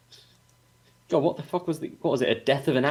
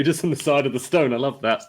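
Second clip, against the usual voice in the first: usual speaking voice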